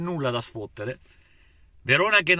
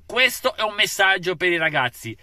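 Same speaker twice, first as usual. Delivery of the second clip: ordinary delivery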